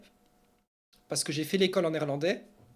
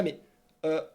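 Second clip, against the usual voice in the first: ordinary talk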